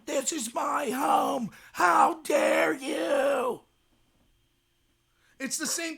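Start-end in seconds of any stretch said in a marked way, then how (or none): 0.0-3.6 slow, tearful mock shout; drawn-out crying yell at a professor; imitating screaming. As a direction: scream it out as an outraged, sobbing student. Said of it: "And they're screaming".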